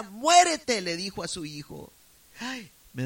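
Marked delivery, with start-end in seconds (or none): 0.0-0.8 loud, higher angry muérete; furious mother. Retold or imitated. Furious mother shouting at her addicted son, loud. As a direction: spit muérete out loud at him. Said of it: "Súper enojada".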